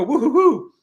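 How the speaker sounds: a high sing-song woo-hoo-hoo; playful imitation of a cheer; imitating his woo-hoo. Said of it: "He really did go".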